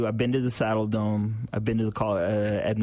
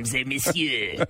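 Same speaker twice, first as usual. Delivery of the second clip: put-on French accent. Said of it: "everything in a French accent".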